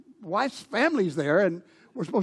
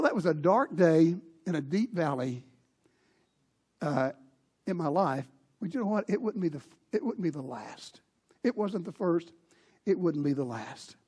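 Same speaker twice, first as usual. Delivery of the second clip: usual speaking voice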